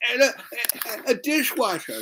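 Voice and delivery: high, stammering mock amazement; imitating a girl too astonished to speak smoothly; amazed girl. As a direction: stumble over the word in high-voiced wonder. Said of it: "She goes".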